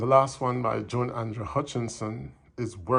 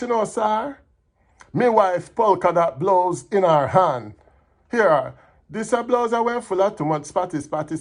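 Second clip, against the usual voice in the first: higher, livelier comic storytelling in Patois; poem recital; 6 semitones higher, 6 dB louder than usual. Acted. Employer telling of a bleach-happy helper, animated recital. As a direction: perform it as animated Jamaican verse.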